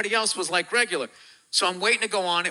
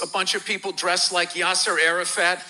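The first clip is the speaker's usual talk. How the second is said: usual speaking voice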